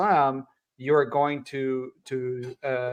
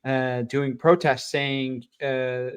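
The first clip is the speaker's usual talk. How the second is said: normal talking voice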